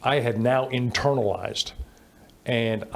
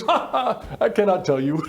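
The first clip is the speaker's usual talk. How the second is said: mock German colonel laugh; 9 semitones higher than usual. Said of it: "I hear this German ac-, accent".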